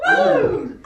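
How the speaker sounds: loud, high woo; whoop. Acted. Whoop after digging move.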